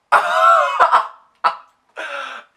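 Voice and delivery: loud high shrieking laugh; acted-out shocked cackle; shocked outburst. Reacting to shocking lock screen.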